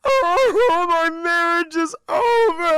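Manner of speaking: high, loud, wailing sob; crying. Retold or imitated. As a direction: wail it through tears, slowly. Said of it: "we hear Wilma crying".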